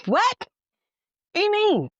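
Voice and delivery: high, loud disbelieving what; incredulous. Retold or imitated. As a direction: shriek it in disbelief. Told not to call the cops.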